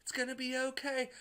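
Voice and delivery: calm him down in a sweet high voice; soothing woman. Retold or imitated. Liv Tyler straddling Hulk to calm him, high gentle voice. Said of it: "and was like".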